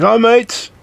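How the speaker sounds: mock Australian, pitched high; hammed-up Australian accent; Aussie accent. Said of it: "Aussie accent".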